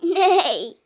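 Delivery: loud falling neigh; whinny it like a horse. A narrator's reading. Horse greets the truck, loud neigh. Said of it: "said a horse".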